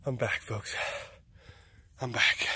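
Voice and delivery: ordinary delivery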